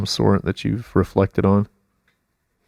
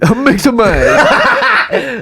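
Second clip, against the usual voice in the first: laughing silly voice; 7 dB louder, much slower than usual. Pretend.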